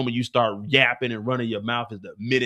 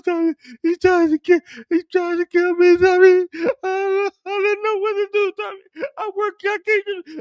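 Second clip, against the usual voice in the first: very high, loud falsetto with gasps; panicked woman; 6 dB louder than usual. Acted. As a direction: gasp and stammer in falsetto. Mireya panicking after attack.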